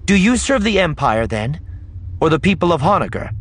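high, loud questioning; public challenge. Acted. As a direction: challenge the ruler so the crowd hears. Leia challenges the dynast publicly.

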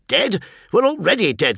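high-pitched, incredulous outburst; angry retort. A narrator's reading. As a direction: throw it back in angry disbelief. Captain throws the word back in anger.

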